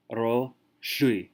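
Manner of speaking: shui said as its own hard syllable, sounded out; phonetic spelling-out. Shown as a demonstration. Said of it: "I've written it phonetically".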